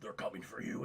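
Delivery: low, secretive warning over the phone; ominous caller. Acted. Mysterious caller warning Chad, low ominous voice.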